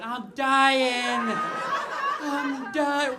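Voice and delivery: loud high shriek of I'm dying; hammed-up panicked wail; mock dying scream. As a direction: yell it like you're really dying. Sprinting around house screaming.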